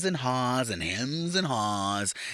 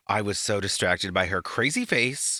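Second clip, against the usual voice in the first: ordinary talk, nothing marked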